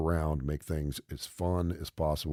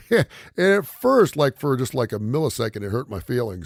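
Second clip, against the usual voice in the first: words bubbling out through laughter, louder; laughing; 5 semitones higher, 7 dB louder than usual. Real, not acted. Dad retelling his daughter's remark, laughing hard. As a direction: laugh through it, still cracking up.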